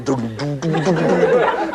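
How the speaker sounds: vocal bass riff with a laugh; bass line imitation. Shown as a demonstration. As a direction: hum it like a bass guitar. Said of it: "a nice big bass".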